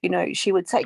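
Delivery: ordinary talk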